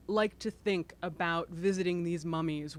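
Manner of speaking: ordinary delivery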